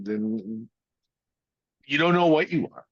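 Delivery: ordinary talk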